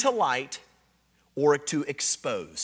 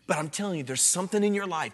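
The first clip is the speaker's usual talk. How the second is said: normal talking voice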